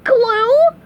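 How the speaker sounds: high, loud disbelieving shriek; high rising cartoon yelp. A narrator's reading. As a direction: shriek it as a horrified question. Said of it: "shrieked SpongeBob".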